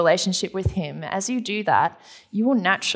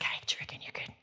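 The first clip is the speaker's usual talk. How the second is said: whispered request; 15 dB quieter, faster than usual. Retold or imitated. Mary asking Jesus secretly.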